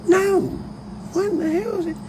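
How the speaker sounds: very high-pitched retold protest; mock disbelief. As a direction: squeak out a mock-baffled retort. Acts out baffled retort.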